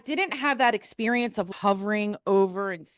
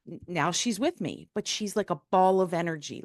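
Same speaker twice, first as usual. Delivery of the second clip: ordinary delivery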